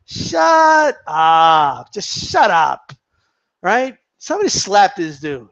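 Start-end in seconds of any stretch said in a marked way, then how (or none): 0.1-2.9 dismissive shut up. Real, not acted. Dismissing the analyst's theory.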